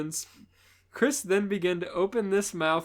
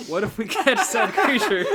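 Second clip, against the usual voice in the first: laughing; 8 semitones higher, 10 dB louder than usual. Acted. Laughing through line.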